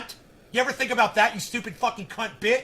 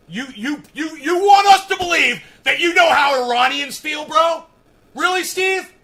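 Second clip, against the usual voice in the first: much higher and louder angry yell; furious shout; 8 semitones higher, 12 dB louder than usual. Real, not acted. Yelling at a commenter on his live stream, furious. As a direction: blow up at him in full rage.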